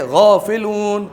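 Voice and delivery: غافلون slowly, the غ pressed from the upper throat; heavy throaty ghain. Shown as a demonstration. Teacher drilling the class on a gargled غ in غافلون. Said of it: "جیسے غرارے کرتے ہیں نا وہ آواز آئے گی".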